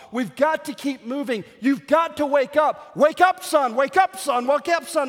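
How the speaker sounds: high, loud, urgent pleading, climbing to a near-scream; desperate father's shout. Acted. Father yelling at his freezing son to stay awake, desperate shout.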